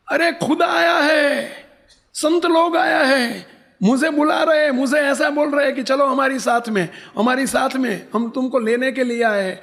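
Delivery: high, stretched, amazed proclamation; urgent crying out. Retold or imitated. Old woman telling darbar God has come, crying out. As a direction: cry out the astonishing news, pitched high.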